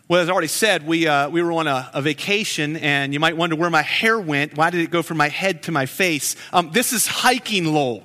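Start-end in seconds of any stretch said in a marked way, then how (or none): none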